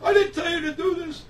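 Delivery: high, loud alarmed protest; imitating a frantic kid protesting; retold panicked screaming. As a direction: burst out in mock panic. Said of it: "screaming on the top of his lungs".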